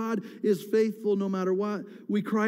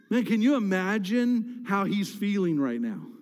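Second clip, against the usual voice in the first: ordinary talk, nothing marked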